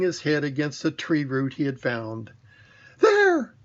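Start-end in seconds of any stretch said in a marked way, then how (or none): 3.0-3.5 very high, louder worried squeak; nervous little pig asking for reassurance; anxious Piglet. A narrator's reading. Piglet, anxious, as they near the trap, very high. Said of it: "said Piglet".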